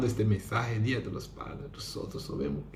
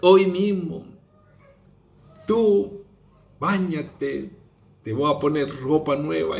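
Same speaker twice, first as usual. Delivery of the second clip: joyful father; 4 semitones higher, 6 dB louder than usual. Acted. Father celebrates son's return.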